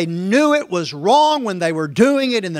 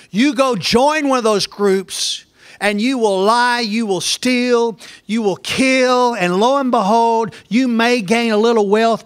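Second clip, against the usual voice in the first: emphatic preaching; 5 semitones higher, 4 dB louder than usual. Real, not acted. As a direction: hammer each phrase like a warning from the pulpit.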